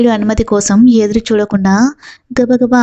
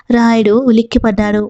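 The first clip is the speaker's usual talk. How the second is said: plain reading voice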